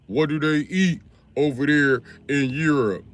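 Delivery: lightly voiced puzzled American, drawn out; slow mock-curious American voice. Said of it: "people in America be like".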